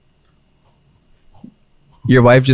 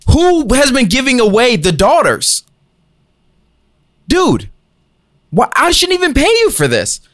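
climbing much higher line by line, incredulous at the officiant; exasperated father; 7 semitones higher than usual. Acted. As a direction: scold the clueless officiant, voice rising in disbelief.

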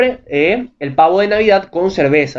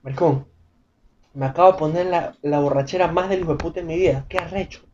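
much softer, dazed morning-after voice; hungover murmur; 2 semitones lower, 7 dB quieter than usual. Retold or imitated. Waking up hungover, mumbling to yourself in disbelief. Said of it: "te quedas mirando y dices".

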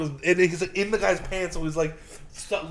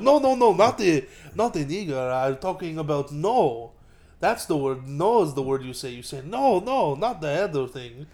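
put-on Israeli accent. Trainer correcting a trainee, mock Israeli accent. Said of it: "he was playing the Israeli".

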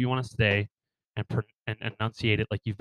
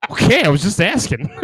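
higher, louder mock-scared voice; scared backing down; 4 semitones higher, 8 dB louder than usual. Scared local backing down, hammed-up alarm. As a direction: back off in exaggerated alarm. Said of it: "then they get scared of you".

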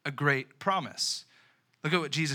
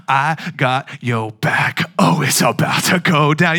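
hyped friend; 2 semitones lower, 10 dB louder, slower than usual. Pretend. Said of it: "your friend says".

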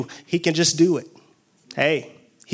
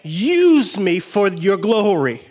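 raised, intense preaching voice; fervent preaching; 5 semitones higher than usual. Real, not acted. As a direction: preach it with fire to the congregation. Preaching at full force.

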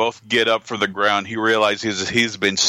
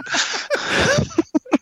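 high burst of laughter; laughing. Real, not acted. After quoting the devil line, high laugh. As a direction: break up laughing.

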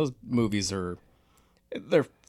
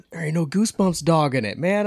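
much higher and louder, a playful protest; mock outraged whine; 9 semitones higher, 7 dB louder than usual. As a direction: complain like a kid missing his favourite dog. Missing the Goosebumps dog, joking complaint.

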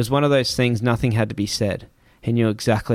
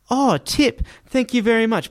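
much higher, gushing mock driver voice; grateful driver; 11 semitones higher than usual. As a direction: imitate the driver thanking you, high and effusive. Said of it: "And he's gone".

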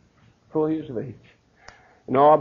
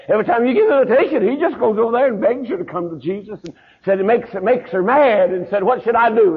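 higher, louder and rushed, a fretting mother at her wits' end; worried pleading; 6 semitones higher, 4 dB louder than usual. Retold or imitated. Wife fretting to Doctor Hamilton about her son, in a high anxious rush. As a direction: pour it out in a hurried, anxious rush.

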